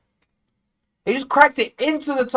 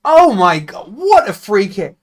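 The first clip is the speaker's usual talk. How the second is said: awed; 5 dB louder than usual. Real, not acted. Replay of free kick.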